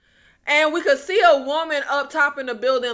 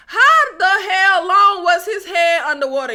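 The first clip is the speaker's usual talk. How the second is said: raised, higher exclamation of disbelief; loud incredulity; 6 semitones higher, 6 dB louder than usual. Real, not acted. Reviewer baffled by the drowning scene, loud disbelief. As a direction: call out the absurdity.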